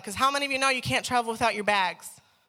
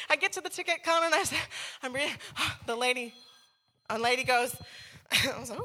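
usual speaking voice

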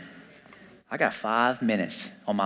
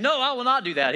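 much higher, firm, indignant no; mock righteous refusal; 10 semitones higher than usual. Abraham as he should have refused Sarah, high mock indignation. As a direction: play the godly man putting his foot down. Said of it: "You'd think he would be like".

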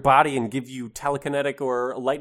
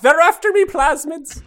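very high, loud cartoon leprechaun; 7 dB louder, slower than usual. Co-host parodying the cereal ad line, leprechaun voice. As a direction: squeal it like a cereal-mascot leprechaun. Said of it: "like the leprechaun from the Lucky Charms commercials".

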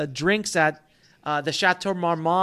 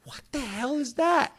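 stunned disbelief; 8 semitones higher, 3 dB quieter than usual. Retold or imitated. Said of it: "And I'm like".

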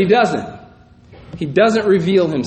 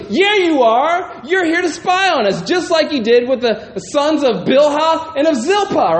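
mock harsh answer; 7 semitones higher than usual. Brothers mock-accusing Joseph of spying, a mild harsh taunt. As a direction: taunt him like a scornful older brother. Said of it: "answered him harshly".

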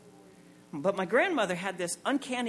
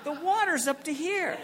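mother's outrage; 9 semitones higher than usual. Retold or imitated. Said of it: "my mother stood up and went".

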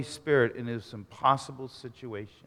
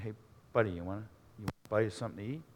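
soft, low, hurried timid offer; 5 semitones lower, 10 dB quieter, much faster than usual. Acted. Nathaniel to a hungry man in the front row, timid and soft. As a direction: offer it meekly, quick and under your breath.

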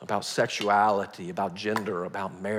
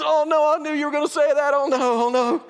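panicked pastor; 11 dB louder than usual. Retold or imitated. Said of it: "He goes".

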